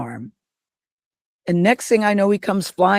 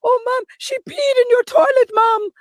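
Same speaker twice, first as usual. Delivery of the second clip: put-on very high Jamaican old woman; Verge. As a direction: squeal it like a panicked Jamaican nanny. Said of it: "Verge is an old Jamaican woman".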